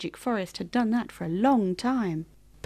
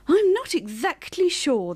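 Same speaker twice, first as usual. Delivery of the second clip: slow, higher unsure reply; shrugging; 7 semitones higher, 3 dB louder than usual. A narrator's reading. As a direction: say it with a drawn-out shrug. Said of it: "the bear shrugged".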